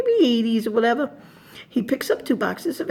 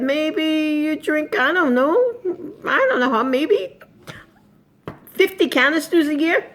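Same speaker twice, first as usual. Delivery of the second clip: higher, halting, questioning guess; unsure guess; 6 semitones higher than usual. Retold or imitated. Shopper guessing for a stranger, hesitant. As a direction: feel your way hesitantly to a wild guess. Retold guess at his coffee intake.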